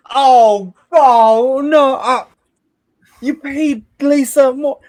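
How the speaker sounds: high, loud, drawn-out dismay; upset quest-giver. Acted. Quest-giver learning the party came back without money, upset. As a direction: wail it in slow disbelief.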